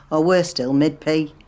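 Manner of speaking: a loud, appalled punchline; horrified outburst. Real, not acted. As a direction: blurt out the worst case, loud. Horrified by the thought.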